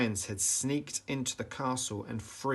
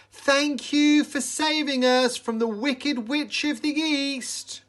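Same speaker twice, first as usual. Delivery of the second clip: high, loud joyful cheer from a crowd; happy crowd cry; 8 dB louder than usual. A narrator's reading. Grateful townsfolk cheering Dorothy, high and loud. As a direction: cheer it out with relief. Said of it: "cried the crowd happily".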